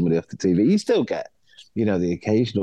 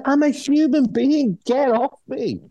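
indignant protest; slower than usual. Retold or imitated. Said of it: "It's like".

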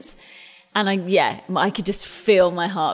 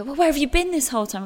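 higher, mock-puzzled question; people asking; 8 semitones higher, faster than usual. Industry people asking where she had been, lightly mimicked higher. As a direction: lightly play the curious public. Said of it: "suddenly people go".

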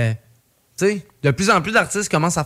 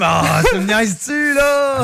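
mock shout; 6 semitones higher, slower than usual. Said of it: "J'étais comme".

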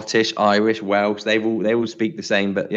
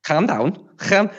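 higher-pitched Scouse with a throaty "kh" on Calm; Scouse accent; 8 semitones higher, much slower than usual. Shown as a demonstration. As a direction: attempt Scouse, scraping the C in Calm. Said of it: "Can you do Scouse?".

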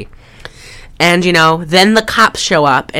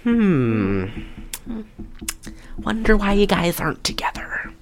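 breathy sarcastic whisper; mock-innocent whispered wondering; sarcastic aside; 3 semitones higher, 10 dB quieter than usual. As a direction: murmur it like a knowing dig. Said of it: "I was like".

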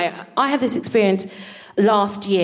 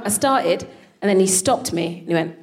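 usual speaking voice

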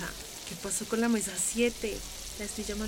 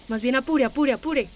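loud, raised urging; pushy kitchen command; impatient hurrying; 3 semitones higher, 8 dB louder than usual. Acted. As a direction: hurry a dawdling coworker along.

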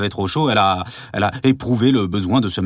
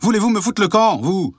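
angry shout; 10 semitones higher than usual. Acted. Husband furious at wife and servant.

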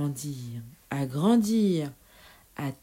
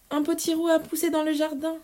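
fast, high, bursting with news; excited mother, faster; 10 semitones higher, faster than usual. Acted. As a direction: rush it out, thrilled.

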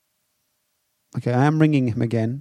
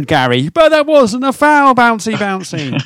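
mock protest; 7 semitones higher, 4 dB louder than usual. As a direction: imitate a footballer whining at the ref. Imitating player protesting referee.

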